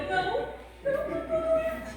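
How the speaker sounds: high, soft, tearful sob-talk; a daughter sobbing to her worried mother; crying voice. Retold or imitated. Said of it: "eu tava chorando tanto".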